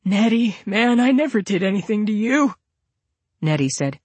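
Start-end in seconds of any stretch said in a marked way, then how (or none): 0.0-2.5 higher-pitched whimpered plea; frightened, whimpering protest. A narrator's reading. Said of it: "He whimpered and said".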